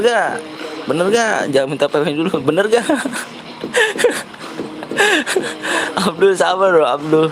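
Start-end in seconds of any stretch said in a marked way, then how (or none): 2.9-6.0 high breathless squeal of laughter. Real, not acted. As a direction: shriek with laughter. Reacting to chat.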